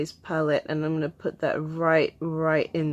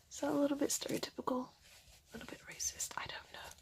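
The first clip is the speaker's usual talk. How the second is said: sheepish whisper under the breath; barely voiced, half to herself; whispered aside; 9 semitones higher, 15 dB quieter, faster than usual. Real, not acted. After joking about her accent, a sheepish whispered aside.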